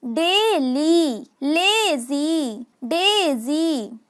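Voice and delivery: डेली, लेजी, डेजी very slowly, each ending in a long "ee"; drawn-out phonics demo, day-leee, lay-zeee, day-zeee. Shown as a demonstration. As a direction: stretch both syllables, holding the final "ee". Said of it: "Y says long E".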